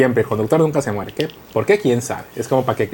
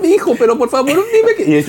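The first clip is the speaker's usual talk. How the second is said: much higher desperate mum voice; pleading mother; 13 semitones higher than usual. Retold or imitated. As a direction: beg like a mother in tears, high. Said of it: "es la mamá". (voz muy aguda de madre rogando)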